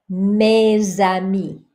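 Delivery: Mes amis with a Z sound linking mes to amis; French liaison: mez-amis. Shown as a demonstration. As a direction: say Mes amis slowly, carrying the S over as Z. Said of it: "here it sounds like a Z".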